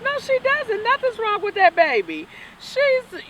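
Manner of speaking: very high, loud protest; incredulous denial. Retold or imitated. Interviewer retelling denying her friend is sick, very high and loud. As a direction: refuse to believe it, protective. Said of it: "I'm like".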